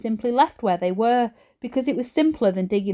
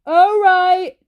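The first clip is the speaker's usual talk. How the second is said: higher, loud shout; shouted back; 10 semitones higher, 8 dB louder than usual. A narrator's reading. Dad shouting back up to Mum, loud. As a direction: call it back up the stairs. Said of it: "Dad shouted back".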